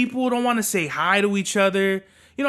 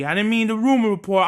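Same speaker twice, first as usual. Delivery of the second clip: usual speaking voice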